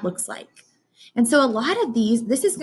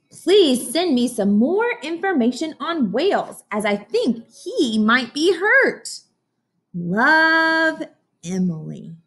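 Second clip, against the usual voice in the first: put-on higher, slower eager child voice; Emily's letter voice; 6 semitones higher, slower than usual. Teacher voicing Emily's plea to her teacher, higher and slow. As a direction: plead sweetly like a worried little girl.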